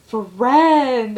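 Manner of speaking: loud, high, drawn-out mock cry of joy; excited greeting. Friend spots the speaker after school, excited greeting. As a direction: imitate an overjoyed friend calling out.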